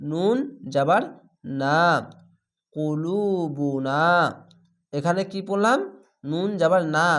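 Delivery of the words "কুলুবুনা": very slow drawn-out Quranic chant; tajweed recitation demo. Shown as a demonstration. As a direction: stretch every syllable of the word. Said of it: "স্লোভাবে একটু পড়ছি কেন".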